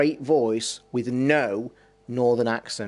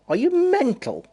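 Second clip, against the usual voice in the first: incredulous; 5 semitones higher than usual. Real, not acted. Disbelief at the troll.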